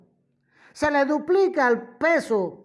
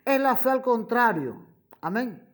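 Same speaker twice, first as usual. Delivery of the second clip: usual speaking voice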